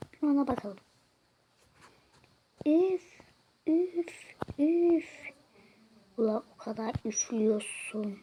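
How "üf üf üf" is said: short sharp puffs of breath; blowing puffs. Acted. Blowing into the breathalyser, sharp puffs. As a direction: puff out air hard, three times.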